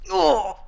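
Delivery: a short high groan of flopping down; imitated sit-down groan. Storyteller replaying the noise she made sitting, short groan. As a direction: mimic the noise of sitting down heavily. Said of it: "that's the noise I made when I sat down".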